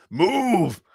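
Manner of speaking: high, drawn-out command; angry mock bellow. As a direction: playfully bellow like an angry giant. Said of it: "When he actually got angry".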